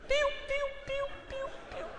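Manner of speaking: high, soft little bird chirps; imitated chirping. Preacher mimics the sister chirping in church, high soft chirps. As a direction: chirp like a tiny chick in the pew. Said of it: "a irmã começou a piar".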